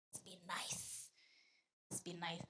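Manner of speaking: hushed mock farewell whisper; whispered goodbye. Saying goodbye to the audience in a dramatic whisper.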